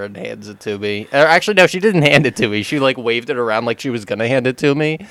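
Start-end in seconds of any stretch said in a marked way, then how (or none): none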